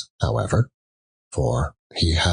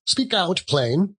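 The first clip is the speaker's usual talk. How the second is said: shrill command; 10 semitones higher than usual. A narrator's reading. Woman orders Joe.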